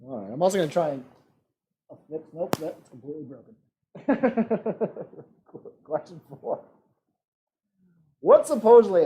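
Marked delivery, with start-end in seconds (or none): 3.9-5.2 high, rapid chuckling laugh; amused high-pitched laughter. Real, not acted. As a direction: giggle in a quick high run. Laughs after something is broken.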